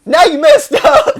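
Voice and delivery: high, slow mock taunt dissolving into laughter; laughing taunt. Friend taunting the cornered guard, laughing. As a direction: tease him and crack up. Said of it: "So I was like".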